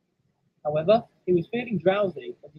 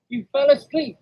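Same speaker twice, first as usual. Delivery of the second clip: raised, loud reproach; scolding driver; 9 semitones higher, 6 dB louder than usual. Acted. Driver scolds the engine that dozed off, cross. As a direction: tell off the sleeper.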